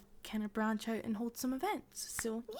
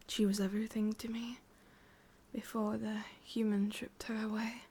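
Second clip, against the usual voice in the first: whispering witch. Acted. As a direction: breathe it close to the ear, sweetly menacing. ASMR witch roleplay.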